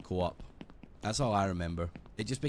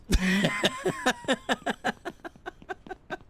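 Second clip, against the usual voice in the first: high laugh. Real, not acted. Laughs at own joke.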